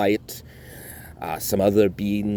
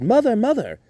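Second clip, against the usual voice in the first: yelling for mother; 14 semitones higher, 4 dB louder than usual. A narrator's reading. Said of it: "he yelled".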